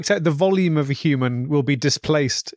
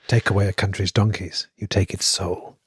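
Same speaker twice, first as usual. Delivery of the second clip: solemn proverb; 7 semitones lower than usual. Pretend. Mock profound proverb.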